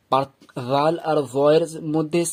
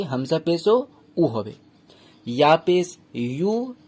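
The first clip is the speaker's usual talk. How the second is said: ordinary talk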